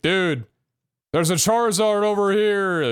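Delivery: high, loud excited yell; excited shout. Retold or imitated. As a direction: call it out across the street. Recalling crowds shouting about Pokemon.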